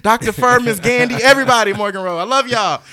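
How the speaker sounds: calling out names loudly and high; loud shout-outs. Real, not acted. Excitedly shouting out old teachers and school.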